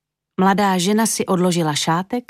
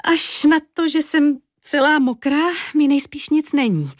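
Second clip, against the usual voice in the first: put-on little girl's voice; 8 semitones higher than usual. A narrator's reading. Drenched girl answers cleaning woman.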